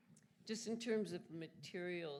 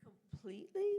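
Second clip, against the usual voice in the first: surprised question; 12 semitones higher, 10 dB louder than usual. Real, not acted. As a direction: pitch jumps way up, louder, in disbelief.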